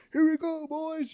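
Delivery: put-on very high, loud call to the boys; rally the squad as the ramp drops; squad leader's call.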